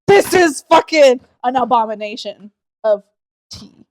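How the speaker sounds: slow, loud, outraged cry of disbelief; hammed-up horror at a bad drink; mock outraged shout. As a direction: yell it like the tea insulted you. Retold outrage at tart tea.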